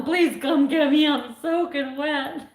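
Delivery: imitating a sobbing phone call, dragged out at the end; retold bawling. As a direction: beg to be rescued, half wailing. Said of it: "I called my husband just bawling".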